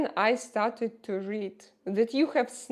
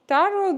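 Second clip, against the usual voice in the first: "tarot" said much higher and louder, set apart; stressed tarot; 10 semitones higher, 10 dB louder than usual. Shown as a demonstration. Streamer correcting a viewer, "tarot" stressed high and loud. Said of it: "it's a tarot".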